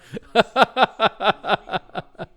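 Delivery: high, loud burst of laughter; real rapid-fire cackle of amusement. Real, not acted. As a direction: laugh out loud in a quick run.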